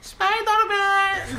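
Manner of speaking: put-on high-pitched Eastern European kid accent; be a sweet Eastern European child, high and drawn out; little Eastern European child. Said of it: "little Eastern European child".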